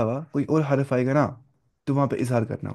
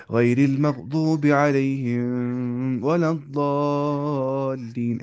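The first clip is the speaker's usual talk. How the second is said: slow, melodic Quran chant; Quranic recitation; much slower than usual. Shown as a demonstration. Teacher chanting a verse for tajweed students, slow and melodic. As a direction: draw the verse out in a measured chant.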